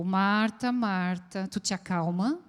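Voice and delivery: gentle reassurance to calm her down. Shown as a demonstration.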